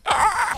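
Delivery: sudden high, loud shriek of fright; jump-scare yelp; a real frightened scream; startled scream. Real, not acted. Startled by an enemy up close.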